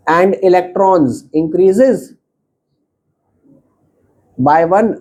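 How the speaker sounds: every word spelled out slowly for students to copy; slow dictation. Acted. Teacher dictating the definition to students, very slow. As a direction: dictate it word by word so they can write.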